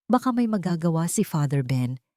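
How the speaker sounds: usual speaking voice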